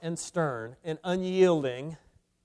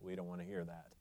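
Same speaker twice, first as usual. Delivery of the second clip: very soft, low undertone; hushed protest; 9 semitones lower, 19 dB quieter, much faster than usual. Acted. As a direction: say it under your breath, pitched way down. Voicing reluctant listeners, then repeated loudly.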